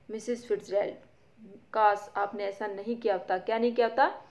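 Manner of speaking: ordinary delivery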